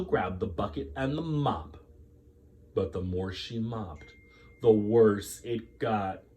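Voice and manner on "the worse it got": usual speaking voice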